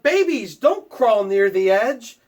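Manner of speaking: high, loud warning yell. Acted. Parent yelling at babies near the ledge, loud warning. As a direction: shout to keep them from the ledge. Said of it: "you yelled".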